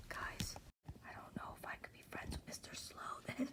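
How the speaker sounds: fast whispered aside. Real, not acted. Teacher whispering to the children. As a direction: confide it in a quick whisper.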